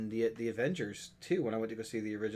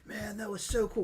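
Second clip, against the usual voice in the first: high-pitched mock amazement; lightly acted, high-pitched awe; retold impressed moviegoers; 9 semitones higher than usual. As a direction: imitate someone thrilled after the movie. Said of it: "they were like".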